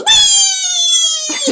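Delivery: put-on high squealing child's shout; gleeful kid. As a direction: squeal like an excited little kid. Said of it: "my little inner six-year-old comes out and goes".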